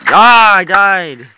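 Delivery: drawn-out cry of defeat; dismayed wail. Real, not acted. Player just died in game.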